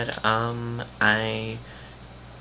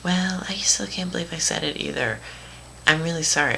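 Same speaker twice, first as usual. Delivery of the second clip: high mock apology; put-on high voice of an apologetic friend; imitated apologetic friend; 7 semitones higher than usual. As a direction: mimic her saying sorry, pitched up. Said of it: "And she's like".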